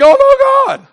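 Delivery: high, squeaky mock panic. Comic fearful reaction.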